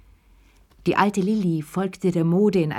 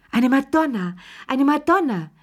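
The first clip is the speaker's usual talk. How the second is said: high, screechy correction; shrieking rapture; 8 semitones higher than usual. A narrator's reading. Proud mother correcting her friend about the bride, shrieking. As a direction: screech it ecstatically, swooping up higher. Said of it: "verbesserte Charlotte Pelou kreischend".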